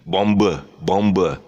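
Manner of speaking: "Bomber" as BOM-ber; B sounded. Shown as a demonstration. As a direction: mistaken hard B in "Bomber". Demonstrates mistaken B in bomber.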